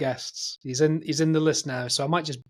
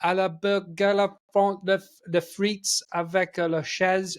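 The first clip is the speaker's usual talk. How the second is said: clumsy French with stumbles; halting schoolboy French; 3 semitones higher than usual. Retold or imitated. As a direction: attempt French haltingly at the counter. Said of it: "I got to the thing and I went".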